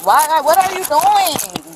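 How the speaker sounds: high, upset and disbelieving; alarmed outcry. Real, not acted. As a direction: cry out in shock. Woman panics as she is cuffed.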